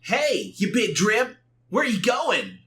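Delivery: high-pitched taunting call; cocky game hero. Acted. Sonic taunting the fleeing monster, cocky. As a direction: call after a foe with playful cartoon bravado.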